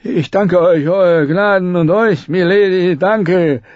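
relieved knight, thanking in a rush; grateful fool. A narrator's reading. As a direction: babble thanks on his knees.

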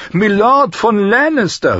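high, loud singer's cry; calling out. A narrator's reading. Singer springs up to hail Lannister, calling out high. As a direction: hail the lord with a high call. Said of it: "rief er aus".

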